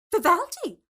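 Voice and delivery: loud, high puzzled question; puzzled surprise. A narrator's reading. Alice puzzled when Maria mentions Vivaldi. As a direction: catch on the name.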